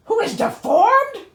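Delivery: high, loud outraged question; indignant disbelief. Real, not acted. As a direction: protest the word deformed. Indignant repeat of deformed.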